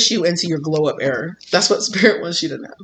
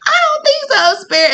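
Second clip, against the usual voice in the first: much higher, sassy "I don't think so"; stubborn refusal; 4 dB louder than usual. Retold or imitated. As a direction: brush Spirit off, cocky and shrill-high.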